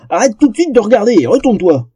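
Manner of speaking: high-pitched indignant order; flustered yelling. A narrator's reading. Naked troll orders the elf to turn, yelling indignantly. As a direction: bawl it out, mortified. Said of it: "s'égousilla Roar".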